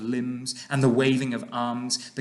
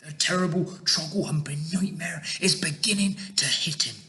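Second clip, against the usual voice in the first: hushed, higher giant whisper; 6 semitones higher than usual. A narrator's reading. BFG explains the nightmare to Sophie, whispered. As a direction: whisper it with relish. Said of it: "the B F G whispered".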